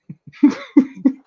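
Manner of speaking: a high giggle burst; high laugh. Real, not acted. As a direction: let a squeaky laugh out. Laugh after Groot joke.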